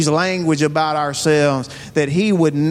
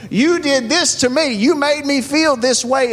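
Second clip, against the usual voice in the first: higher-pitched acted accusation; blaming someone else; 7 semitones higher than usual. Acting out blaming somebody else for our feelings, higher-pitched accusation. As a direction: put the blame on them, pitched up. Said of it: "put on somebody else how we're feeling".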